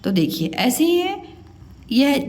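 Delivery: ordinary delivery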